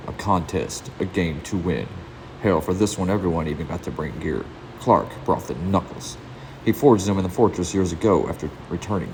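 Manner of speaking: intense, driving story narration; dramatic narration. A narrator's reading. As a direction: read it like a brooding comic-book voiceover.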